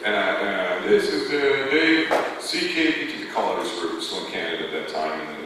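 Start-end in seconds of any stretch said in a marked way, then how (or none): 0.8-3.2 higher, louder mock station ID; mimicking radio announcer. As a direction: put on a kid's pretend DJ voice. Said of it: "it was me mimicking being on the radio".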